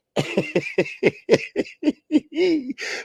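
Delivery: long high wheezing laugh; drawn-out high giggling fit; real laughter. Real, not acted. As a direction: crack up for several seconds, high and loud. Laughs at his parents' bickering.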